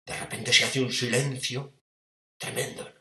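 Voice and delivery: ordinary delivery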